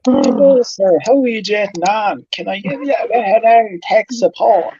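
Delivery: put-on high, hammy Irish accent. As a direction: lay on a thick Irish accent. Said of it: "your most stereotypical Irish accent".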